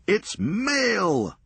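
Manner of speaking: high, triumphant shout of joy; jubilant cry. Acted. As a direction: hold it up and cheer. Jubilantly raising the box.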